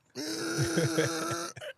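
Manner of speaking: long, high, held beep; imitated car horn. Truck driver mimics a woman beeping at him, long horn noise. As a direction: sustain a honking horn noise. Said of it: "lady's beeping me like".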